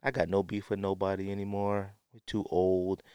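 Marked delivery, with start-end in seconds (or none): none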